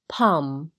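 palm with no L sound; silent L. Shown as a demonstration. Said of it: "L不读出来的单字还有". (palm的L不读出来)

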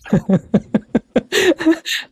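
high, rippling chuckle; amused laugh. Real, not acted. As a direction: laugh at your own quip. Laughs at own remark on rebirth.

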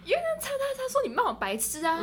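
high mocking bully voice; lightly mocked bully.

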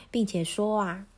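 usual speaking voice

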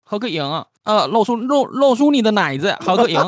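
high, loud Beijing hustler; Beijing accent. Pretend. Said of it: "有点北京腔了".

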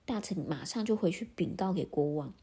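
ordinary delivery, nothing marked

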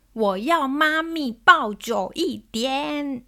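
put-on high, drawn-out, loud child's demand; angry demand. Daughter demanding a longer hug from mum, angry and loud. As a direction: imitate an upset daughter insisting, word by word. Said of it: "她就很生气的，很大声的这样说".

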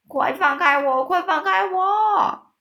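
loud shout climbing very high and slow. A narrator's reading. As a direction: yell it, then stretch the repeat up high. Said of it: "大叫".

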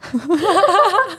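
a high, embarrassed burst of laughter. Real, not acted. Asked to shout, laughing in embarrassment. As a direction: laugh off the request.

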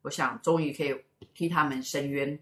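ordinary delivery

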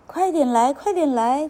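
put-on high, urging child's call; children calling out. A narrator's reading. Children to the duckling, calling urgently. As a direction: hurry someone along.

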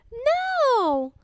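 put-on high, childish no; a stubborn child's refusal; little princess. A narrator's reading. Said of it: "said the little princess".

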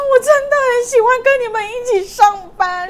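high, slow, loud mock sobbing; drunk crying. Drunk coworker sobbing to his friends, high mock wail. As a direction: wail it like a weepy drunk, slowly. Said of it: "他就开始哭".

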